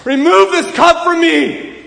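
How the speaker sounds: loud anguished cry, pitched high and slowed. Acted. Jesus crying out to God in Gethsemane, loud anguished plea. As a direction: cry it out in agony. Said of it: "He's crying".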